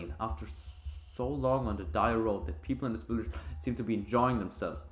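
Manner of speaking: ordinary delivery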